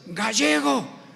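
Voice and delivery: imitating a towering pastor pointing and calling out; menacing call. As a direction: call him out like a big angry pastor. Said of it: "así con una voz amenazante".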